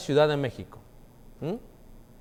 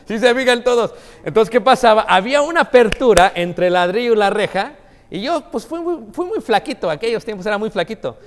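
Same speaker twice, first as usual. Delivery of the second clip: high, loud and laughing; excited preacher laughing at himself; excited laughing telling; 6 semitones higher, 6 dB louder than usual. Real, not acted. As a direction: tell it with a grin and growing laughter.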